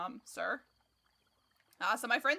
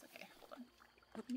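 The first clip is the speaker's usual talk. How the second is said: whispering; 2 semitones higher, 16 dB quieter, slower than usual. Real, not acted. Concentrating on tricky fight.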